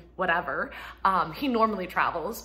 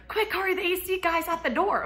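much higher urgent call; imitating kid's alarm; 11 semitones higher, 4 dB louder than usual. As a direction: play the child bursting in. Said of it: "again, ran in".

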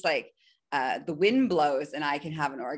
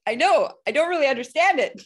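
exasperated admission; 10 semitones higher, 9 dB louder, faster than usual. Retold or imitated. Confesses bafflement in retold chat.

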